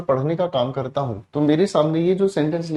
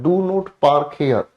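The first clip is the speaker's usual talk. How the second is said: each word slow and deliberate; slow prohibition; 2 semitones lower, much slower than usual. Shown as a demonstration. Teacher reading a no-parking sign slowly to the class. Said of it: "जैसे कहीं पर लिखा हुआ है".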